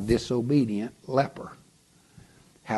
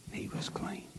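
awed whisper; 11 semitones higher, 14 dB quieter than usual. A narrator's reading. Climax of the healing.

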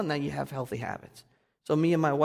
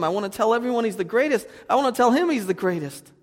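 fast, then higher and louder with rising joy; overjoyed new believer; 7 semitones higher, 6 dB louder than usual. Retold or imitated. Pastor reliving his own joy right after being saved, building excited rush. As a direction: gush like someone who just found faith. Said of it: "I remember days after I got saved just crying".

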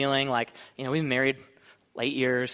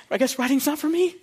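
mock student voice; 11 semitones higher, 3 dB louder than usual. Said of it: "She just dropped her paper".

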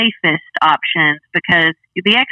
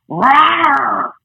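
playful growling tiger roar, higher; 7 semitones higher than usual. Pretend. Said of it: "If you were a tiger, what sound would you make".

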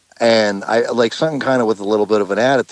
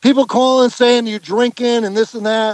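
mock cop rant; 4 dB louder than usual. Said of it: "He's like".

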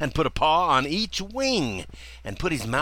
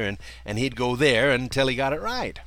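ordinary delivery

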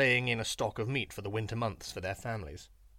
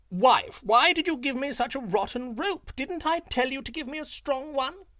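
high, loud jackal fake lament; mock wailing shout; 12 semitones higher, 4 dB louder than usual. A narrator's reading. Jackal fakes outrage at his wife for Lion, loud and high. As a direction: ham up the grief for Lion's ears.

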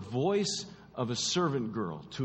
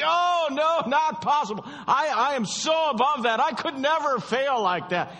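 high, loud, haughty denial; boastful Peter; 13 semitones higher, 7 dB louder than usual. Acted. Preacher voicing Peter's haughty boast, high and loud. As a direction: scoff at the very idea of failing.

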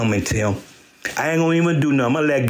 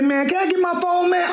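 demanding phone. Retold or imitated. Asking thief for phone.

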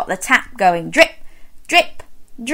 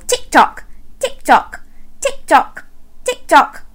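clock ticking; 7 semitones higher than usual. Shown as a demonstration. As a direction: tap out a clock beat. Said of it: "do that with me and go".

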